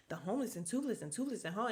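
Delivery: fast, slightly soft mock mumble; imitating Kathy. Host mimicking Kathy whispering to Garcelle, fast tipsy mumble. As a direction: tumble the words in a tipsy rush.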